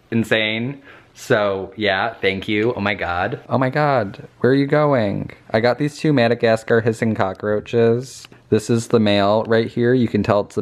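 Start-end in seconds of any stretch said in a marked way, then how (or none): none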